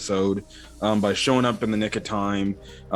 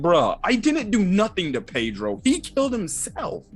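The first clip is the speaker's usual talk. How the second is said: lightly high, defensive whine; whiny Perospero; 9 semitones higher than usual. Retold or imitated. Perospero excuses himself to Carrot, whiny. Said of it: "he's like".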